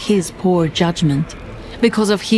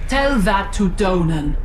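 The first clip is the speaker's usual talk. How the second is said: mocking head. A narrator's reading. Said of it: "mocked one of them".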